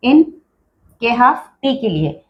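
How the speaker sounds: usual speaking voice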